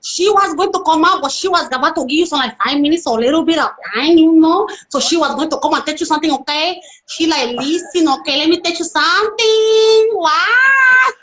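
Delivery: put-on high, rapid Latin American accent; Juanita Chiquita. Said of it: "from the Latin countries".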